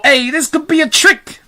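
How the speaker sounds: high, urgent warning; high-pitched suspicious character voice; fire chief's voice. A narrator's reading. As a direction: warn the others in alarm. Fire chief warns the others.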